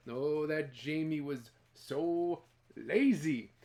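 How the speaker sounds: ordinary delivery, nothing marked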